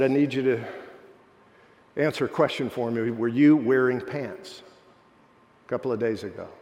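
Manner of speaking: low, measured retelling of an interrogation; stern questioning. Retold or imitated. Administrator questions student about wearing pants, stern and measured.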